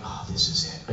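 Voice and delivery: soft unvoiced whisper of doom; whispered dread. Traveler asked for his passport, whispered dread. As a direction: breathe it like the end has come.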